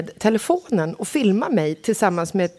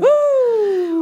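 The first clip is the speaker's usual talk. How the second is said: high, louder whoop; joyful birthday whoop; cheer; 14 semitones higher, 4 dB louder than usual. Real, not acted. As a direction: cheer like a fan.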